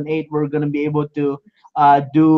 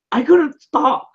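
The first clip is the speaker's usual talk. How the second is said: disbelief; 8 semitones higher than usual. Real, not acted. Overwhelmed, laughing disbelief.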